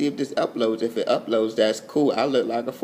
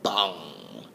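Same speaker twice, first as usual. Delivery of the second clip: very low, drawn-out "bong"; meditation gong imitation; 6 semitones lower, 6 dB quieter than usual. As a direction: intone a deep, soft gong. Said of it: "meditation music".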